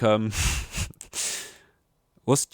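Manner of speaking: ordinary talk, nothing marked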